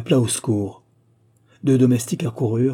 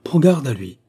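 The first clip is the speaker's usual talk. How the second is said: higher, louder GARDE À LUI; urgent, loud stress on GARDE À LUI; forceful warning; 4 semitones higher, 6 dB louder than usual. A narrator's reading. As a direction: raise the voice to warn on GARDE. Second warning, escalated.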